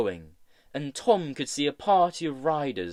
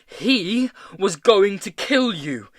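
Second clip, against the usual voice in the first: raised, emphatic protest; indignant, higher and louder objection; 5 semitones higher, 7 dB louder than usual. A narrator's reading. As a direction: protest loudly in self-defence. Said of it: "he protested".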